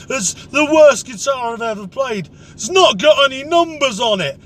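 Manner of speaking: a mock drunk blaming the guitar; drunk bloke complaining. Drunk pub guitarist blaming the instrument, in an indignant mock voice.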